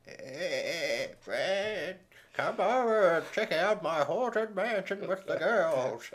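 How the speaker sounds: put-on high, slow old man voice. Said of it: "I'll do my old man voice".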